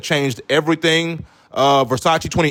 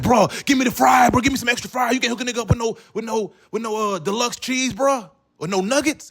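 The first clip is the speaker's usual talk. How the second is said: a buddy at the counter hustling for free fries, pitched way up and singsong; pushy homie begging; 9 semitones higher than usual. Retold or imitated. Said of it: "your homie's like".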